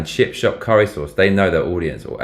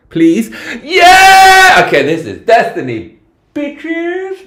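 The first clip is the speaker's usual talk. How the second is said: shrieking with joy, much higher and louder; excited shriek; 10 dB louder, much slower than usual. Real, not acted. Vlogger finds crumble on the menu, shrieking with joy. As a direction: squeal it like you found treasure.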